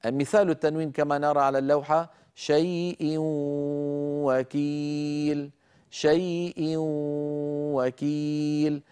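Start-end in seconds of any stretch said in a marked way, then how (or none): 2.3-5.5 "شيء وكيل" with tanween as a long nasal waw. Shown as a demonstration. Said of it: "أدغمنا التنوين في الواو". 5.9-8.8 "شيء وكيل" with tanween as a long nasal waw. Shown as a demonstration. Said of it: "بغنة كما أسلفت".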